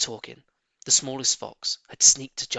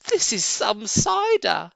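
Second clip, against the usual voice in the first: much higher, louder, slow gasp; breathless and amazed; gasping cub; 10 semitones higher, 6 dB louder, slower than usual. A narrator's reading. Small fox gasping over the strong cider. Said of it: "gasped the small fox".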